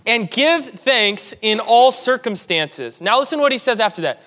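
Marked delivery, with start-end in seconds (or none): 0.1-3.0 very slow, every word weighed; slow solemn quote. Acted. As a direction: lean into each word of the verse. Quoting scripture with weight.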